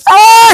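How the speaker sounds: sharp high startled "Ah"; a quick gasp of alarm. Pretend. Passenger startled when the car brakes, gasping loud. Startled driver reacting to braking.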